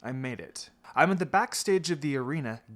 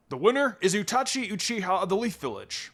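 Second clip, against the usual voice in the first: announcer call; 6 semitones higher, 4 dB louder than usual. A narrator's reading. Announcer declares winner.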